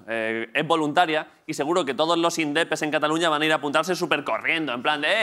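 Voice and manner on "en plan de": ordinary talk, nothing marked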